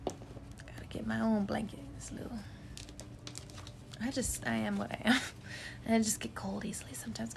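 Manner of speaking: soft unvoiced aside; whispering. Real, not acted. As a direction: whisper it to yourself. Whispers aside about blanket.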